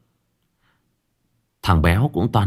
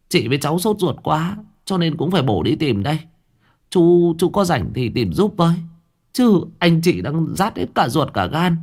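higher-pitched, choked-up pleading; 7 semitones higher than usual. A narrator's reading. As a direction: plead like an anxious mother holding back tears. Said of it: "Bà Giang giọng nghẹn ngào". (giọng nghẹn ngào, van nài)

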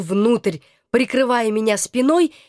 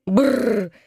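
ordinary delivery

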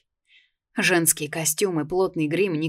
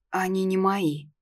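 sulky grumble; sulky teen mumble; 2 semitones higher than usual. Acted. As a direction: mumble it sulkily. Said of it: "зачем-то пробурчала я".

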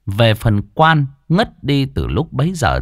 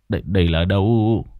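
mếu máo. Acted. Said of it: "mếu máo".

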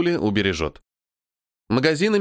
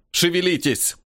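shouting orders over battle; 6 semitones higher than usual. Acted. Ordering soldiers over gunfire.

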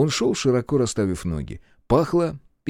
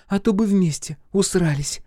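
high, taunting voice; mocking; 11 semitones higher than usual. A narrator's reading. Centauress rubbing it in, mocking. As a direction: tease him in a high voice. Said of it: "издевалась кентавриса".

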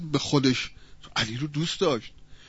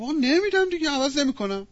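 acted stubborn refusal; 8 semitones higher than usual. Butcher pushes away and refuses.

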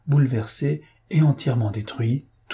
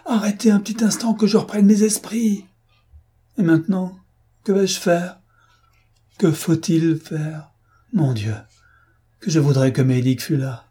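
panic fading to helplessness; 6 semitones higher than usual. A narrator's reading. Fotheringay alone in the storm, panic easing into despair. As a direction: start frantic, then sink into helpless wishing.

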